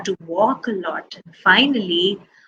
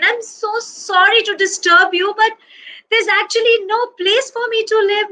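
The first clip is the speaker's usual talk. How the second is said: high-pitched, apologetic storybook bear; earnest high-voiced regret; pleading apology; 10 semitones higher than usual. A narrator's reading. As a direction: plead your case as a homeless polar bear.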